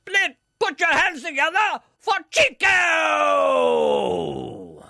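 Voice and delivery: slow, high show-introduction shout; big build-up, then stretch out the name; Peter Dixon. Acted. Spoof announcer introducing an act to the audience, in a shouted announcer voice. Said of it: "Take this encounter with X Factor announcer Peter Dixon".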